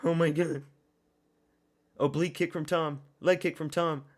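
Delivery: usual speaking voice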